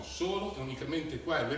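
ordinary talk, nothing marked

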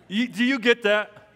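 ordinary talk, nothing marked

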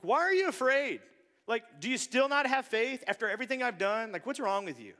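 imitating an angry Jesus; mad Jesus. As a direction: snap at them like an annoyed teacher. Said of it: "I've always assumed Jesus was mad".

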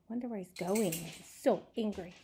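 ordinary delivery, nothing marked

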